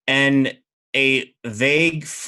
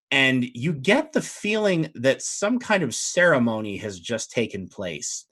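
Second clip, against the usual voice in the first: ordinary talk, nothing marked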